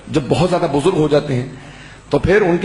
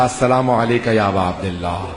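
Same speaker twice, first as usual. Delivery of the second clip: trembling greeting; 4 semitones lower than usual. Acted. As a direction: greet the Imam, trembling with exhaustion, down low. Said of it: "کانپتا ہوا میں نے کہا". (زیارت کرنے والا تھکا ہوا بیٹا، لرزتی دھیمی آواز میں سلام)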